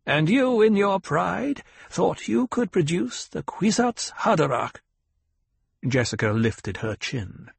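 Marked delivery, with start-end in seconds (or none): 0.1-4.8 put-on higher, stern old woman; a demanding elder questioning her pupil; old Reverend Mother. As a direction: severe old matriarch.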